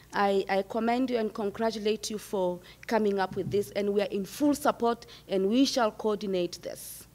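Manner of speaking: normal talking voice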